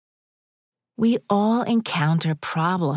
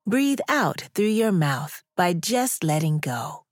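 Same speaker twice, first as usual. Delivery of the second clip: guided breathing. Acted. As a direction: gently guide the breaths, unhurried. Leads a breathing exercise.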